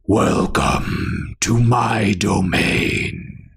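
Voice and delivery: slow, looming voice in the head; booming echoing villain monologue. Acted. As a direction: speak slowly like an ancient presence. Entity speaks in her head.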